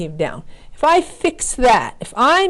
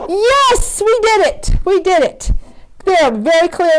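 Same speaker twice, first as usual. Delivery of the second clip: a real burst of triumph; excited cheer; 8 semitones higher than usual. Real, not acted. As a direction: celebrate like you just nailed it. Triumph finishing graph.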